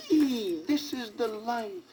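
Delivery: high, chirpy little bird voice; chirping bird. A narrator's reading. Sidney as a bird chirping above the trees. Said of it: "he chirped".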